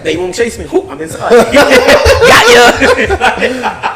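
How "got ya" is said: high, loud gloating laugh; laughing tease. Pretend. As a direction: crow with laughter at getting away. Teasing after escaping inside.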